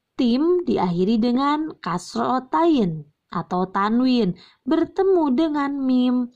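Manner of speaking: ordinary delivery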